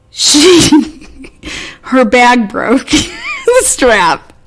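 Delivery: laughing through the words as she tells it; breaking into laughter mid-sentence. Real, not acted. Telling friends about the snapped strap, laughing.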